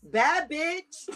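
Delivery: put-on drawn-out, playful Georgian accent; hammed-up Georgian drawl. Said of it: "put on my best Georgian accent".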